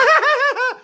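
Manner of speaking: sudden high, loud laugh burst; nervous cackle. Acted. Subway man asked what's funny, high nervous cackle. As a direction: laugh against your will. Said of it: "he laughs when he's nervous".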